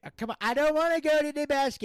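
high, loud, fast whine; whiny Gator voice. Pretend. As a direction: whine like a sulking kid. Whiny character refusal.

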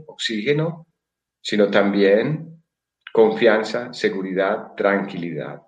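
guided meditation. Acted. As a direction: lull them into calm, slowly.

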